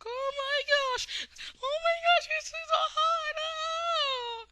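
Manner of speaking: shrill screaming fangirl squeal. Retold or imitated. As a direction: squeal like a swooning fan. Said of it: "I just totally failed in my fangirl voice there".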